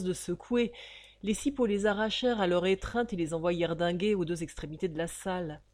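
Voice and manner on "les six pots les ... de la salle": usual speaking voice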